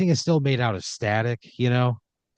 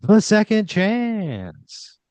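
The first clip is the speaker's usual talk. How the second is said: much higher and slow, drawn-out announcement; dramatic host reveal, stretched out; game show host; 10 semitones higher, 5 dB louder, much slower than usual. Acted. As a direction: unveil the prize like a big reveal.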